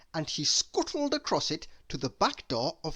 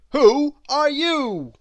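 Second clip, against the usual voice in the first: loud, hearty giant's hello; Mister Strong's voice; 11 semitones higher, 12 dB louder, slower than usual. A narrator's reading. Mister Strong greeting tiny Miss Tiny, hearty and loud. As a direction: greet her big and booming. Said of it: "he said".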